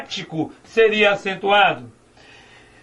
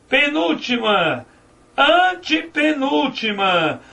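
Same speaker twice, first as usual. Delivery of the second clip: slow, "antepenúltima" stretched high; counting syllables back slowly; syllables drawn out; 5 semitones higher, slower than usual. Shown as a demonstration. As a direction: draw out "penúltima" and "antepenúltima". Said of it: "a sílaba que pode ser prolongada".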